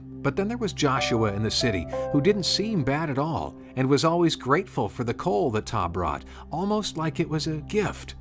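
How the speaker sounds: usual speaking voice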